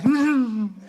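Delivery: high closed-up giggle; shy laugh. Shown as a demonstration. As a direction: imitate a bashful little laugh. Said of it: "And some are shy".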